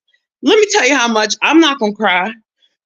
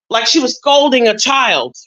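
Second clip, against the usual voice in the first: ordinary talk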